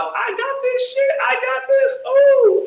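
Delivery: high, slow sing-song; lost in the music, sung high; grooving to music. Retold or imitated. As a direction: vibe along to the music. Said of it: "I was like".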